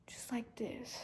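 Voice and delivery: soft whispered demo of slow breathing. Shown as a demonstration. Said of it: "I want you to slow down gradually".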